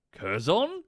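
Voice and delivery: puzzled recognition, pitch swinging up; questioning double take. Acted. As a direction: ask the name in acted disbelief. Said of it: "sort of looks at her and goes".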